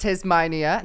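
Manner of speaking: drawn-out Aussie Tasmania with a rise; mock Australian accent. Shown as a demonstration. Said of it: "is that more like New Zealand".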